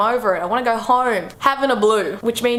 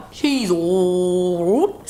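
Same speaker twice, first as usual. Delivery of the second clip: slow drawn out; 3 semitones lower, much slower than usual. Shown as a demonstration. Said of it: "repeats drawn out".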